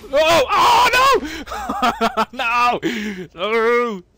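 loud high oh no with laughter; laughing shouts. Real, not acted. Shot down again, loud laughing cries. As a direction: yell and laugh at once.

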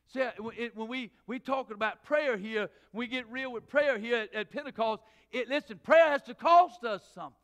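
loud, rising preacher's cadence; full-voiced sermon delivery; preaching at full force. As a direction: drive it home to the pews. Preaching to congregation.

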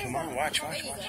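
hushed. Real, not acted. Hushed at the exhibit.

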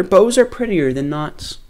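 a second thinker chimes in; another thinker. Acted. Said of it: "said another".

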